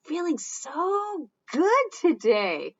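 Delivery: slow high happy voice. Acted. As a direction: beam it out slowly. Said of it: "I'm feeling".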